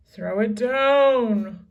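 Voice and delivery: loud, slow demanding; greedy command. A narrator's reading. Captain demanding the medallion, slow loud order. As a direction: bellow for it. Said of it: "cried Cap'n Bones".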